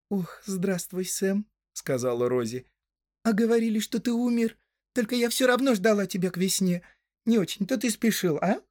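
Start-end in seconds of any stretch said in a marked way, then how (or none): 0.1-1.5 high-pitched put-on female greeting; young woman's voice. A narrator's reading. Rosie greeting returned hobbit, young woman's voice. 3.2-8.6 high-pitched put-on girl's voice; Rosie, reproachful. A narrator's reading. Rosie to late-returning sweetheart, high reproachful voice.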